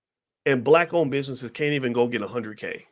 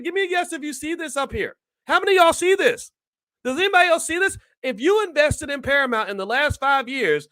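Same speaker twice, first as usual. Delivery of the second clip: high-pitched, fired-up indignant ranting; pissed off rant; 11 semitones higher than usual. Real, not acted. Asking viewers if they see Paramount's losses, heated outraged rant. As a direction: stir the viewers up over the losses. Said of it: "do I sound a little pissed?".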